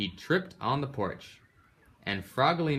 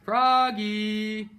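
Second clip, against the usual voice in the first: much higher, louder call across the house; 12 semitones higher, 5 dB louder than usual. Acted. Mother calls Froggy from across the house, high and loud. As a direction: call him from another room. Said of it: "called his mother".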